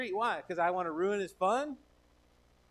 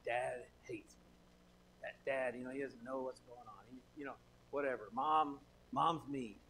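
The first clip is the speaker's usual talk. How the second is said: put-on sulky kid; 4 semitones lower, 4 dB quieter than usual. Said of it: "they huff off into the room upset".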